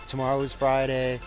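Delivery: ordinary talk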